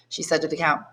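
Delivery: usual speaking voice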